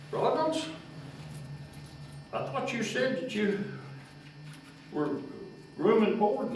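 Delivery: high mock-quizzical foreman voice; teasing foreman. Foreman mockingly teasing the young barrel worker. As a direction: rib the young man.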